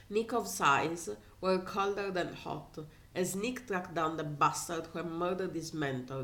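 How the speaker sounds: ordinary delivery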